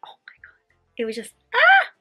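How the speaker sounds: ordinary delivery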